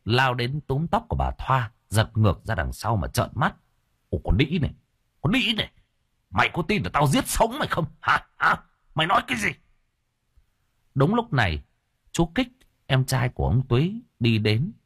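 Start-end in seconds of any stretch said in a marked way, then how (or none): 4.1-9.5 furious threats climbing high; aggressive, high-pitched angry demand; enraged husband. Acted. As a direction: lash out at your wife, pitch rising. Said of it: "Ông Túy nghe vợ mắng thì điên tiết".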